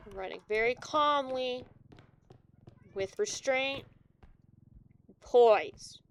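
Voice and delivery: loud, half-panicked claim of poise; loud ironic calm. Real, not acted. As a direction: insist you're calm while yelling.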